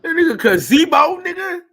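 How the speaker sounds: high, loud yelp of disbelief; amazed shout. Real, not acted. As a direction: shriek it out in amazement. Amazed at a neighbor's gazebo.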